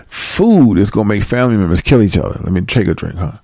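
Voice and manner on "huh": normal talking voice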